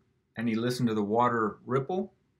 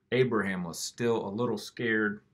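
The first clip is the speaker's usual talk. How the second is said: ordinary delivery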